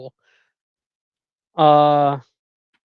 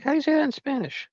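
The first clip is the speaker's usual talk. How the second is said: high questioning voice; 12 semitones higher than usual. Retold or imitated. Retold own question, high.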